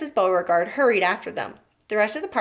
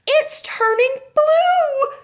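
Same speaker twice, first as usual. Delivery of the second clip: very high, slow, drawn-out scream; a mother screaming in horror; 6 dB louder, much slower than usual. A narrator's reading. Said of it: "screamed Missus Beauregard".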